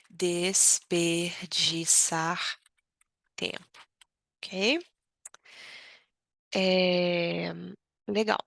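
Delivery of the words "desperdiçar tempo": very slowly stretched, syllable by syllable; desperdiçar drawn out long and slow. Shown as a demonstration. Teacher drawing out desperdiçar tempo for learners. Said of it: "é o sentido de desperdiçar".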